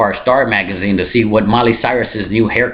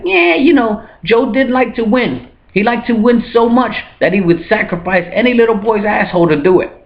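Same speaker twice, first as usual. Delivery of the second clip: high-pitched, hammed-up dismissive excuse; mock apologist; 11 semitones higher than usual. University folks five years later excusing Joe, high mock justification.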